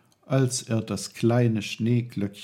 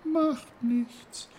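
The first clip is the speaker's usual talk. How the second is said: weak whisper; 3 dB louder than usual. Acted. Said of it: "flüsterte das Schneeglöckchen schwach".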